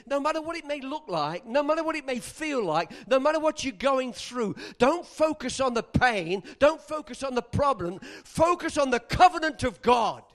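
loud, driving sermon cadence; rousing pulpit shout; full-force preaching. Acted.

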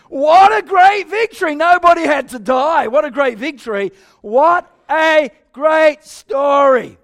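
loud high cry of delight; ringing, slowed exultation; joyful exclaiming. Real, not acted. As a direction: celebrate the story with the room. Preacher celebrates the victory.

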